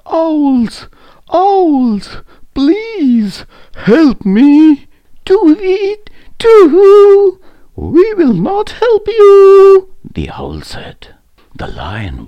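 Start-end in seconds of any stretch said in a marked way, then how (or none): 0.1-4.9 very high, loud cry for help; desperate trapped lion calling up high; pleading call. A narrator's reading. Trapped lion calls to owls. 5.3-9.9 very high, loud hoots; hoot, then refuse in the same slow owl voice; drawn-out high owl call. A narrator's reading. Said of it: "he heard some owls hooting".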